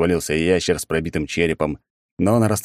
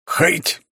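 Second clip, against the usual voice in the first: throwing grunt; 11 semitones higher than usual. A narrator's reading. Lizard throwing dart.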